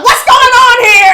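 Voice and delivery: high, loud, slow demand; imitating angry sister. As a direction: bellow it up high like an outraged sister. Sister Linda storms up.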